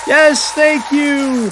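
high, slow mock call of thanks; milk the pretend applause; calling to crowd. Host thanking a pretend studio audience, calling out.

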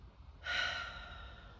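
long, breathy exhale; slow sigh out. Real, not acted. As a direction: let a deep calming breath all the way out. Deep breath exercise before reading.

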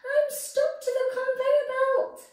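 high squeaky vegetable voice; high distressed little voice; squeaky carrot. Acted. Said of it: "cried Carrot".

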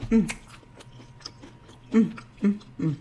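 humming while chewing food; closed-mouth muffled mm sounds; mouth full chewing. Real, not acted. Seen 0: Just bit shrimp; closed mouth chewing, holding shrimp piece.

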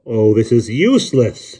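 slow, loud, tired complaint; exasperated gasp. A narrator's reading. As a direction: give up in weary frustration. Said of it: "Jonathan gasped".

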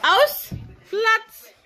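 high loud dog command; sharp command. Real, not acted. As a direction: snap the command at the dogs. Commanding dogs, sharp call.

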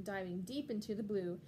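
plain reading voice

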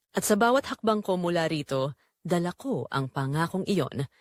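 normal talking voice

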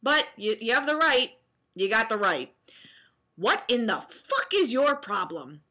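usual speaking voice

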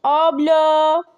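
loud call, stretched out slowly; a child calling loudly for his sister in the locked school. Acted. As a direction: shout down the empty hallway for her. Child calls through the school.

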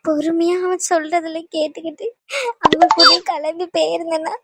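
halting, sob-choked confession; tearful. Acted. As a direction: grieve for your father through tears. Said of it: "எதுக்கு நீ இப்படி அழுதுகிட்டு இருக்க".

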